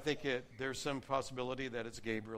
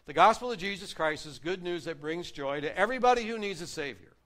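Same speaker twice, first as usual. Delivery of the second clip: louder, higher preaching climax; preaching at full force; 5 semitones higher, 8 dB louder than usual. Acted. Pastor proclaiming the gospel to everybody, full force. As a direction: build to a ringing proclamation.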